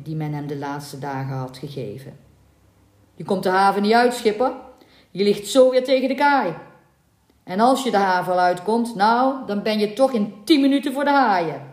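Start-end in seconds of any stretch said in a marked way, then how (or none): 3.1-11.6 mocking warning, louder and higher; lively voiced harbour warning; warning sailors. A narrator's reading. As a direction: jeer at the stubborn skipper. Voicing others' warnings.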